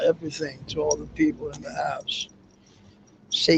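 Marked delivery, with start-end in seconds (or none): 0.1-2.3 sudden fast whisper trailing off. Real, not acted. To the people in the house, whispering fast. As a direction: hush it so the house stays asleep.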